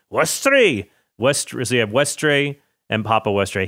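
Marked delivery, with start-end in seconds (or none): 0.1-0.9 bad Scottish accent. Naming the last island for listeners in a mock Scottish accent. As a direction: say "Westray" like a mock Scotsman. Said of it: "he wasn't gonna do a Scottish accent anymore, and then he did".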